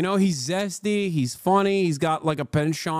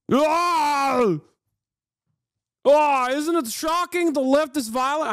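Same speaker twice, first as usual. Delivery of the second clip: exaggerated, higher-pitched parody of a politician's outrage; bad Elon Musk; 5 semitones higher than usual. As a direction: do a badly faked accent in mock shock. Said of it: "Not your best accent".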